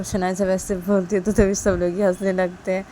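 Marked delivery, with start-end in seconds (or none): none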